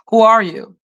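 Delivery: normal talking voice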